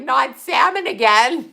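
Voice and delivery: mock whiny son. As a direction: drag out the complaint. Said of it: "He'd be like".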